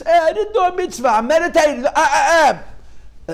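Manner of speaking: high, loud mock objection; dismissive spiritual meditator. Voicing the meditator's lofty thoughts.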